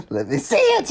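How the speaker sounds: high, strained greedy voice; creepy Bilbo. Retold or imitated. Fan imitating Bilbo's scary ring lunge in a strangled high voice. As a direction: reach for it with creepy hunger. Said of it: "he's like".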